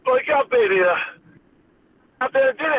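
put-on amazed old mentor egging him on; excited high Dusty impression; Dusty Rhodes voice. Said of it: "doing Dusty Rhodes' voice".

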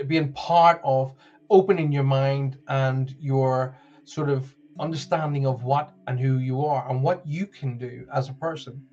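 normal talking voice

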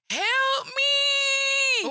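put-on high, loud, screaming child's cry for help; screaming kid. Mom imitating her toddler screaming during a work call, shrieking high and loud. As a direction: belt it like a toddler wailing from the next room.

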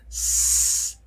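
slow, voiceless sss; just air through the teeth; long hiss. Shown as a demonstration. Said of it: "el sonido sin voz, sin vibración".

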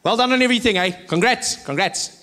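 excited send-off from a local; excited well-wishers. Acted. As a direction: cheer him on like a hometown fan. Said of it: "people would say to me".

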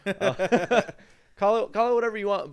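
high, loud laughing words. Real, not acted. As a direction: laugh through it. Laughing after sarcasm remark.

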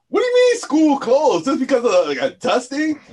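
loud, high-pitched incredulous outcry; indignant Northerners questioning a snow closing; mock disbelief. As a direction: protest in exaggerated disbelief. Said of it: "mad people from up north was like".

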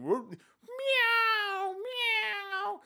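high whoop and meowing; mock animal noises. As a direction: squeal out silly cat calls. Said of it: "What kind of noises".

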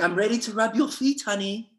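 sweet doting wife. Retold or imitated. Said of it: "she's like".

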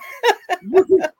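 loud giggle; high loud laugh. Real, not acted. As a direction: burst out laughing. Laughing after guest name.

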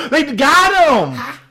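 high, drawn-out gleeful cry; overly excited. Retold or imitated. Host reliving seeing the speeder pulled over, overly excited. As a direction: crow it slowly in high-pitched delight. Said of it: "I was just overly excited".

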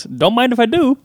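cheeky Swedish driver grabbing the whiskey; Bjorn's Swedish accent. Retold or imitated. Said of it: "and he goes".

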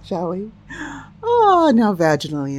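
usual speaking voice

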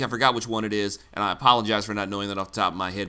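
ordinary talk, nothing marked

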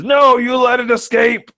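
high, slow quoted dog line. Retold or imitated. Quoting the dog Chance's line.

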